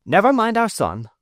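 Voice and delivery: high, loud wife cutting in; wife snapping back at her husband; wife crying out. A narrator's reading. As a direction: brush the question aside sharply. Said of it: "cried my wife".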